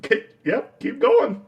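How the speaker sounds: lightly voiced smug Tom Hardy egging on; amused dare. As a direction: goad him on, breaking into laughter. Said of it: "He's like".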